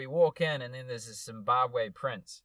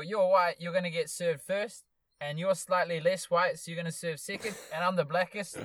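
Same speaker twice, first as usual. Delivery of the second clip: higher-pitched mock Zimbabwean accent; 5 semitones higher than usual. Zimbabwean guy ranking who gets served, imitated Zimbabwean accent. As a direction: lay down the order in a put-on Zimbabwean voice. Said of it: "the Zimbabwe guy".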